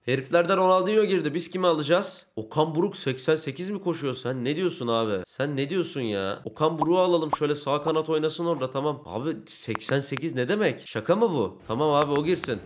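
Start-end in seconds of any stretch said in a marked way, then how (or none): none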